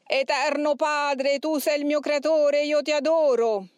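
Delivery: high, slow, forced demon voice; horrible demonic voice. A narrator's reading. Overpowered demon made to adore God, horrible straining voice. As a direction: force it out with tremendous, repugnant effort. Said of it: "con una voce orribile".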